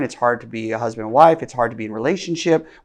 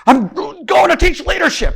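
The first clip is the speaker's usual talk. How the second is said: high, loud frustrated yell; exasperated shout; 8 dB louder than usual. Retold or imitated. A dad storms out after arguing with his daughter, shouting high and loud. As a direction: blurt it out in exasperation, much higher and louder. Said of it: "I go".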